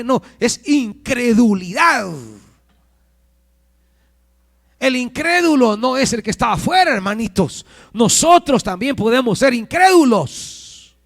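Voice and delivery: high, loud exhortation; impassioned preaching. Acted. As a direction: press the warning on the congregation. Preaches at full force.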